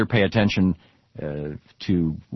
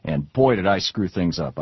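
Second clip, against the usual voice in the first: usual speaking voice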